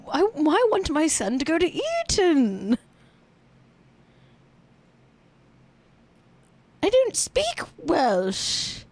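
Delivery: silly British royal accent mocking The Crown; British royals impression. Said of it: "that's my impression of The Crown".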